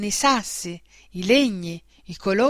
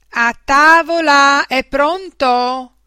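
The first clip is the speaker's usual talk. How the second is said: loud, high, drawn-out dinner call; mum calling out; 5 semitones higher, 8 dB louder, slower than usual. A narrator's reading. Mum calling her child to the table, sing-out shout. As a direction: call across the house, stretching the vowels. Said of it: "fece una voce di mamma".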